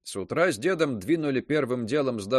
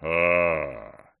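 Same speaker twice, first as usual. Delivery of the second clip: deep grunt; 8 semitones lower than usual. A narrator's reading. Dwarf's first grunt.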